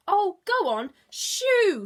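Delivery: high, loud squawk; squawking bird. Acted. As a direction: shoo him off with a squawk. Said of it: "squawked the bird".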